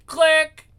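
high, loud spoken click; imitated phone click. Reader voicing the phone hanging up, high loud click. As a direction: pop it out as a sound effect. Phone hang-up noise voiced.

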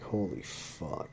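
slow, soft disbelief under the breath; stunned mutter. Retold or imitated. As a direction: let it sink in quietly. Stunned reaction to her brush-off.

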